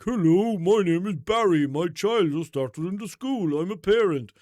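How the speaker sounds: loud, high, sing-song fake parent; Barry. Fake parent introducing himself in group chat, exaggerated put-on voice. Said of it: "You should make a mock account and, uh, be like".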